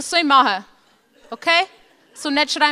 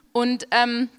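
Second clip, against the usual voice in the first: ordinary delivery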